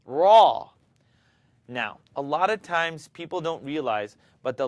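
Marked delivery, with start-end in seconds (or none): 0.1-0.7 raw said loud, lips flat, no O shape; lips unrounded. Shown as a demonstration. Teacher shows raw without rounding the lips. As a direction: keep the lips still on raw. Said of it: "Don't use your lips to pronounce raw".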